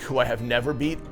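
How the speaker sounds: ordinary delivery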